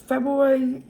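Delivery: ordinary delivery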